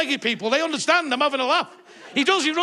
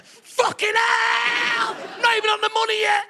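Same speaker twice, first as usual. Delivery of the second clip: king's anguished outburst; 8 semitones higher, slower than usual. Retold or imitated. The king shouting in anguished outrage at his cancer diagnosis. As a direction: wail it like the king hearing bad news.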